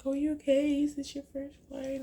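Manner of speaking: a lightly voiced kind attendant; caring flight attendant. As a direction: check on a nervous flyer kindly. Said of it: "So she's like".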